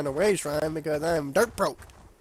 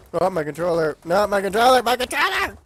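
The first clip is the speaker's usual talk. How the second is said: building from groan to high wail; rising panic over dead controller; dismayed outcry; 4 semitones higher, 6 dB louder than usual. Real, not acted. Controller dies mid-game.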